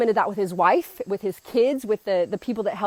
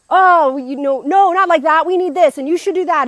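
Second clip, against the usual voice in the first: raised, louder, bossy mock yell; retold yelling; 6 semitones higher, 8 dB louder than usual. Patient ordering his nurse around, imitated loud yell. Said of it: "like, yelling at her".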